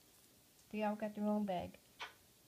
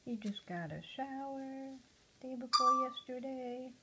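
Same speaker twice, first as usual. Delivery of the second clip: normal talking voice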